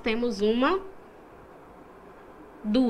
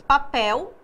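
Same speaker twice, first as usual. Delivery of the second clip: "Papel" with "pel" hit louder, pitch rising at the end; stress on last syllable; 5 dB louder than usual. Shown as a demonstration. Teacher showing the class the stressed syllable, "Papel" with a loud final "pel". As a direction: land the weight on "pel" in "Papel". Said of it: "a última sílaba é a mais forte".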